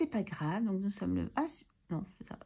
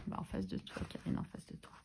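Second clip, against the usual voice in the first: whisper; 8 dB quieter than usual. Real, not acted. Answers herself, calling own name.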